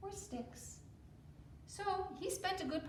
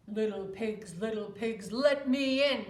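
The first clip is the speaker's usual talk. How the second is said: louder, slow menacing wolf; big bad wolf; 9 dB louder, slower than usual. Wolf at the straw house door, slow and menacing. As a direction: lean on each word like a predator.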